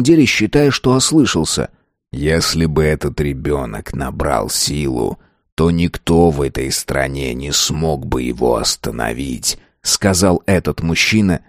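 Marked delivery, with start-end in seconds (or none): 2.1-9.6 low cold voice. A narrator's reading. Mighty man explains coldly.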